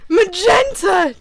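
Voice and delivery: high, loud, slow call. Acted. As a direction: call her name across the woods. Jaden calling after her.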